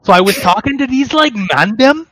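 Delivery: high, loud put-on Toronto slang voice; mock Toronto youth accent. Podcaster mocking Toronto zoomers for friends, in a mock Toronto youth accent. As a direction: ham up the Toronto roadman drawl.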